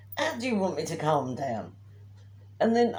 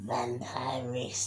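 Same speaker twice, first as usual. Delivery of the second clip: breathy, slow whispered jeer; mocking whisper; 4 semitones lower, 5 dB quieter, much slower than usual. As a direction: hiss the word slowly like a taunt. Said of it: "walked past going".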